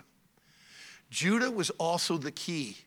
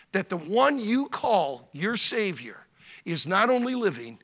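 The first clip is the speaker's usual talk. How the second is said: ordinary talk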